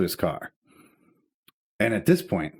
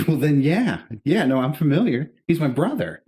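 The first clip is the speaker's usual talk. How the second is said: higher, louder retold reply; raised, emphatic comeback; exasperated admission; 6 semitones higher, 4 dB louder than usual. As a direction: concede it as if blindingly obvious. Acted sarcastic reply to the cop.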